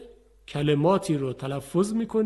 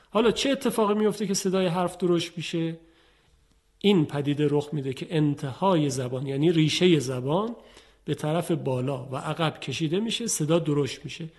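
ordinary talk

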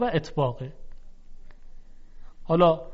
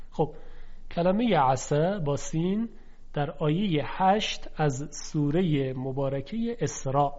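ordinary talk

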